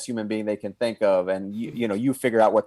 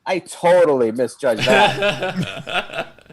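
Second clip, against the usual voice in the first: laughing; 4 semitones higher, 3 dB louder, much slower than usual. Real, not acted. Sheepish admission, laughing.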